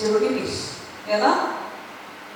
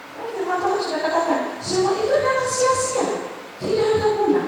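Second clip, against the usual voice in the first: much higher, ringing proclamation; preaching climax; 13 semitones higher than usual. Acted. Preacher crying out scripture to the congregation, much higher. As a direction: lift it up as God's word.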